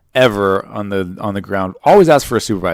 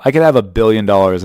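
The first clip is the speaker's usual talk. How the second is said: normal talking voice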